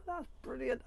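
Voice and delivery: high, delighted giggle into praise; giddy laugh. Real, not acted. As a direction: laugh with pure joy. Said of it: "I'm so excited".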